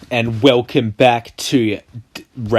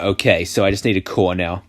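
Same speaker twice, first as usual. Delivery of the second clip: usual speaking voice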